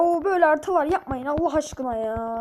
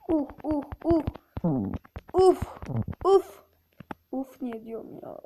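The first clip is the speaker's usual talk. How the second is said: ordinary delivery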